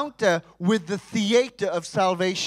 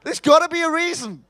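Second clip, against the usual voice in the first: higher, louder anxious thinking; mock frantic husband; 7 semitones higher, 4 dB louder, faster than usual. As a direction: panic a little as the puzzled man.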